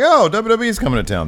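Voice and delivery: mock surprise. Imitating surprised stranger.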